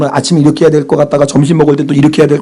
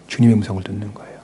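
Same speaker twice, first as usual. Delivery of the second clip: ordinary talk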